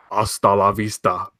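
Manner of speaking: low Terminator catchphrase in a put-on accent; put-on movie accent. Host teasing the next film, put-on Terminator accent. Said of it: "when I do this different accent".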